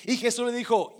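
usual speaking voice

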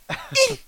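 very high imitated squeak of exertion; tiny mouse-like grunts, spaced apart; squeaky mouse grunt. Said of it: "he sounded like a little mouse".

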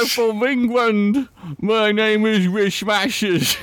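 high, slow hammed-up Cockney English accent; mock English accent. Mocking a listener's English accent, high slow Cockney accent. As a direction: lay on a silly English accent. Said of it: "That's what he sounds like".

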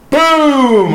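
sudden high shouted boom for the impact; loud boom. Retold or imitated. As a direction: burst out with the explosion. Retelling second plane impact.

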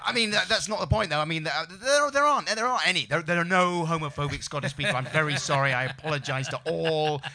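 mock-panicked on-air apology, tripping over words; flustered backpedalling. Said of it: "You start qualifying it and saying".

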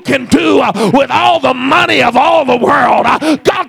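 loud, driving preaching with huffed uh breaths; full-force preaching. Acted. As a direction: shout it to the back pew.

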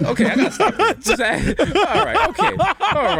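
breaking up laughing, pitched high; can barely talk through the laugh. Real, not acted. Host cracks up at the guest's accent, laughing hard.

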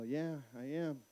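low, soft admission; subdued dad answer. Retold or imitated. Dad admits to daughter he's leaving, retold quiet answer. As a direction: act out gently confirming bad news.

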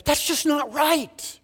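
high, slow voice of indignation; indignant protest. Acted. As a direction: protest slowly, pitched well up, at an injustice. Inner protest at injustice, acted.

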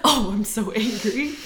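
laughing, drawn-out repeat. Real, not acted. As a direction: echo it through laughter. Echoing with laughter.

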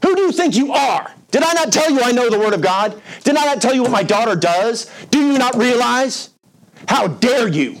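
rear up and scold him, offended to the core; indignant outburst. Retold or imitated. Dying woman scolding the young preacher, high outraged voice. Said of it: "She said".

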